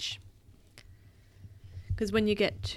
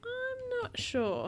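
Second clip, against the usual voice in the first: slow, high, soft singsong; softly musing, high-pitched; unsure; 6 dB quieter, much slower than usual. Real, not acted. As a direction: draw it out in a high voice while wondering. Parent ponders child's question.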